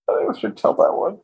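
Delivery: usual speaking voice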